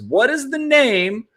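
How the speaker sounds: slow, drawn-out, high quiz question; dramatic quiz host. Retold or imitated. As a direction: read it like a game-show host. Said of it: "the question that buried you was".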